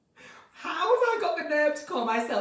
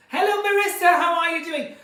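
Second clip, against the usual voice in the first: high, loud Northern Irish greeting; Northern Irish accent attempt; 6 semitones higher, 7 dB louder than usual. Shown as a demonstration. Greeting a viewer, loud Northern Irish accent attempt. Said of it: "Northern Ireland".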